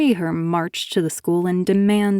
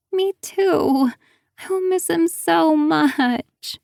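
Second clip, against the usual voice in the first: much higher, slow tearful wail; 10 semitones higher, slower than usual. A narrator's reading. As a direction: wail it through tears. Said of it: "she wailed".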